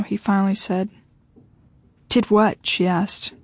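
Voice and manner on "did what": usual speaking voice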